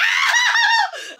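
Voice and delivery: hammed-up laughing shriek, very high; exaggerated yelp. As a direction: fake a big painful cry, laughing. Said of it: "but I go exaggerated".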